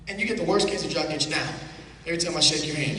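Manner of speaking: ordinary delivery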